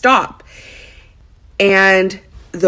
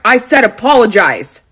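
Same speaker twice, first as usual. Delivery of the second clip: louder retold demand; stern mock scolding, voice raised; waiter not letting up; 3 semitones higher, 5 dB louder than usual. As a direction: imitate an insistent, angry waiter. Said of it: "the guy would not let up".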